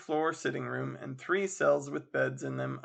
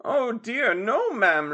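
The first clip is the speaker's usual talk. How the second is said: pitying landlady; 8 semitones higher, 7 dB louder, slower than usual. Acted. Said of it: "with a benign smile of pity".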